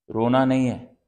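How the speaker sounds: loud, firm order; stern command. Acted. Parent forbidding Adi to cry, loud and stern. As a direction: order the child firmly to stop.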